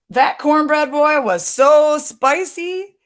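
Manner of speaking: high, drawn-out storybook climax; excited reveal. A narrator's reading. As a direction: stretch out the big punchline with rising excitement. Climax of the story, spicy surprise.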